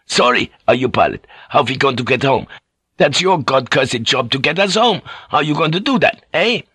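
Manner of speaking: put-on angry, demanding sailor with a foreign accent; Fink. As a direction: demand an answer, increasingly worked up.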